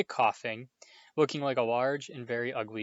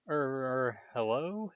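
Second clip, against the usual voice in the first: nervous; slower than usual. A narrator's reading. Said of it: "said Harry nervously".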